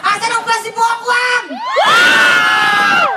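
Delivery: high, projected hype call; rally the fans to cheer; host's high-pitched crowd holler; hyping the crowd. Real, not acted.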